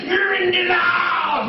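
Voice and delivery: high, slow, drawn-out chant of the room; mock group yell. The group yelling at Jerome in the chair, drawn-out mock yell. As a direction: stretch it out like the whole circle yelling. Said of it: "they're yelling".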